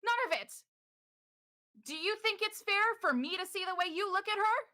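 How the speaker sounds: stammer, then climb into a jealous demand; Morrow's heated reply. Acted. Morrow confronting Ystera about her ex, high and jealous. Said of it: "Morrow goes".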